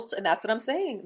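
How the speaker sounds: ordinary talk, nothing marked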